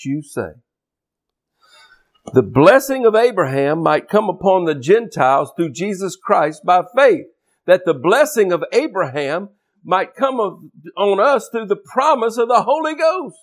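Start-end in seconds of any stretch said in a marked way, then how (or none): 2.3-13.4 verse proclaimed with rising pitch; emphatic preaching. Real, not acted. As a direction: build to a high, fervent finish.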